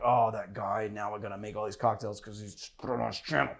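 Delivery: low, very soft mock grumble; grumbling imitation. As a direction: imitate an annoyed worker complaining under their breath. Imitates annoyed bar staff complaining.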